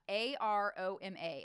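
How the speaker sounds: A R O M A spelled letter by letter, slowly; letters of A R O M A said one by one. Shown as a demonstration. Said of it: "an acronym for".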